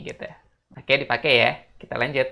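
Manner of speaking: usual speaking voice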